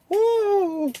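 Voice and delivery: high whoop down the chimney; excited woo. Acted. Mickey whooping as he slides down. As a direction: whoop while sliding.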